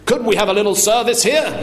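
high, imperious demand for service; shouting. Acted. Father demands service from the waiter, shouting. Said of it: "he shouted".

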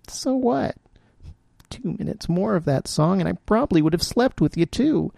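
a lightly voiced hurt woman; bitter heroine. A narrator's reading. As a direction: tell him off with bitter irony.

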